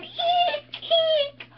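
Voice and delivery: tiny high fake squeals; mock eek. Cheerleader fakes a frightened squeal for the killer. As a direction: squeak it out, hammed-up. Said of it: "am I supposed to run".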